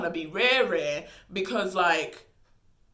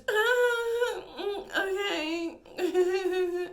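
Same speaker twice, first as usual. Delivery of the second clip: high, strained and very slow, half laughing; awkward okay; 11 semitones higher, much slower than usual. Retold or imitated. To the brother who just declared his feelings, awkward drawn-out okay. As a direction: stall with a pained, drawn-out okay. Awkward reply to sudden confession.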